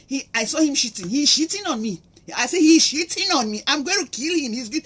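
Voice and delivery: hammed-up threat to kill him; furious cheated wife. Wife raging about cheating husband.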